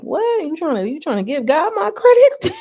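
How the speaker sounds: very high incredulous squeal breaking into a laugh; mock incredulous. Retelling his disbelief at giving God credit, high incredulous mockery. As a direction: imitate disbelief, pitch shooting way up.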